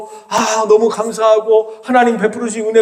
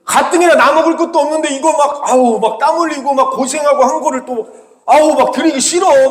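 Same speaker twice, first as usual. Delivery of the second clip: annoyed grumbling; 8 semitones higher, 3 dB louder than usual. Retold or imitated. Voicing Cain's grumbling.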